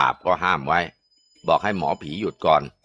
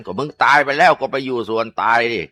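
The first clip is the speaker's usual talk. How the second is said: scolding shaman; 5 semitones higher, 5 dB louder than usual. Acted.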